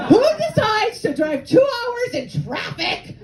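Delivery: high, slow incredulous shout; high-pitched comic outrage; outraged rant. Acted. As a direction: shout the question in disbelief. Ranting about cottages.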